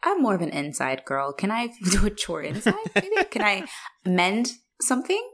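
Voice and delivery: high, prim voice of a dainty colonial daughter; a fussy old-timey girl, pitched high; sixteen thirties girl. Pretend. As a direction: play the pampered indoor maiden pleading with mother. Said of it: "sixteen thirties persona".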